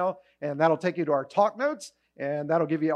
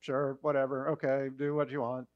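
softer, lower bored shrug; indifferent; 5 semitones lower, 8 dB quieter than usual. Retold or imitated. Imitating an indifferent God answering prayer. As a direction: sound like you don't care at all. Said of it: "his basic attitude is".

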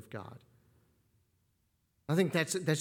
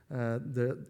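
ordinary talk

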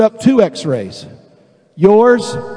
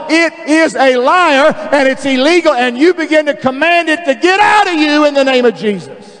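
preaching at full force; 5 semitones higher than usual. Acted. Preacher commands sickness to leave, full preaching force. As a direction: build it up and proclaim it over the congregation.

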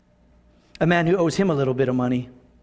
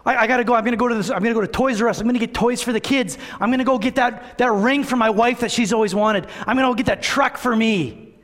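giddy excitement; 6 semitones higher than usual. Pretend. Forgiven servant excitedly plans spending.

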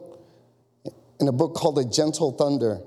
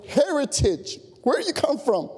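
eager questions, pitched much higher; heart-racing excitement; 10 semitones higher than usual. A narrator's reading. Narrator asking a fellow believer's heritage, growing excited. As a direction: fire off high, excited questions. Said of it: "My heart began to beat faster".

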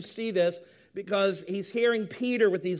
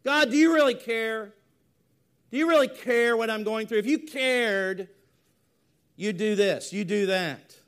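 louder, raised, insistent questioning; challenging prayer; 3 semitones higher, 5 dB louder than usual. Acted. Believer demanding that God show care, insistent plea. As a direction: press God for an answer, easing slightly at the end.

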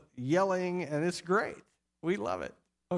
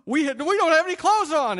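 much higher and louder, hammed-up alarm at "clothes"; mock dim-witted shock; 11 semitones higher, 9 dB louder, faster than usual. Naked couple discovering their nakedness, hammed-up shocked cry. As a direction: play a clueless couple suddenly realising they're naked. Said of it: "this couple was somehow a dim bulb".

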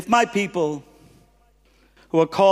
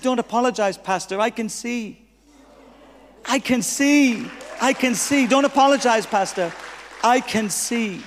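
excited healed woman; 5 semitones higher than usual. Retold or imitated. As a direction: cry out with joy at seeing again. Healed old woman cries out.

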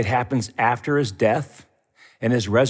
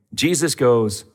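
ordinary talk, nothing marked

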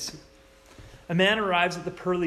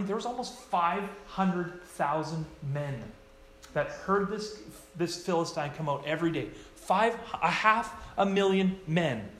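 normal talking voice

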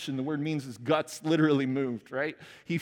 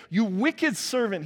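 loud, slow rebuke; angry master; 6 semitones higher, 4 dB louder, slower than usual. Acted. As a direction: scold him from above. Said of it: "he said".

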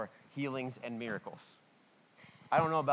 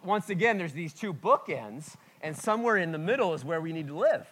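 normal talking voice

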